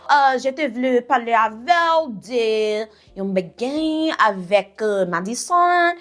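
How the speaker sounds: lightly voiced halting French full of euhs; mocking cousin. Cousin Yolanda rambling in French on the phone, mild mockery. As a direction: stumble around the point like she does. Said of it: "This is how she freaking talks".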